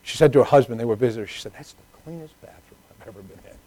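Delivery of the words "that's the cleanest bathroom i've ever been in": very soft imitated remark; hushed aside. Woman visitor to her husband about the bathroom, hushed. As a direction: say it under your breath, barely audible.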